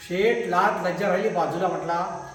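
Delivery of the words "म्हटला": plain reading voice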